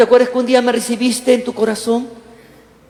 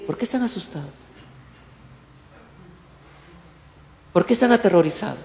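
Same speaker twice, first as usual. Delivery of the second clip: calm, quiet Jesus; 3 semitones lower, 7 dB quieter, much faster than usual. Retold or imitated. Jesus speaks amid the storm.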